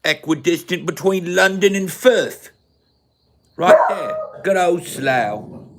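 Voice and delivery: hammed-up English local-pride accent; put-on English accent, swooping pitch; mock British accent. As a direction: boast in an exaggerated Slough accent. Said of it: "We talk like this".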